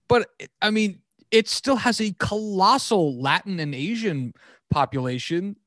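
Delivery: normal talking voice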